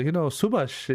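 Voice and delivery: "Subhash" lifted high with a falling lilt; imitating teacher's lilt. Naming Subhash the way his teacher would, high falling lilt. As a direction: lightly echo the teacher's Indian-English tune. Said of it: "there's a way he sounds".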